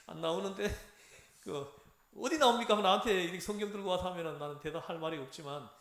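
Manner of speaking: ordinary delivery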